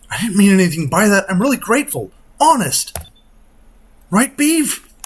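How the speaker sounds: put-on a girl scrambling to make amends; flustered girl voice. Girl hastily apologizes to captain.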